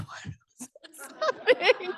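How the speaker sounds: whispered then a high laugh; stunned, breaking into giggles; giggling bewilderment. Retold or imitated. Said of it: "I'm like".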